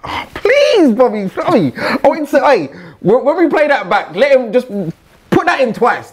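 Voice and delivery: high, excited pleading; playful pleading. Pretend. Jokingly begs producer to replay.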